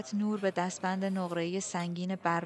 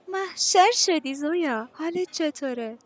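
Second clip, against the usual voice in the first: high, loud, drawn-out gushing; thrilled squeal of a greeting, stretched out; excited; 9 semitones higher, 8 dB louder, slower than usual. A narrator's reading. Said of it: "ماری هیجان زده گفت".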